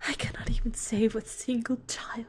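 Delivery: Goat Mom farewell. Acted.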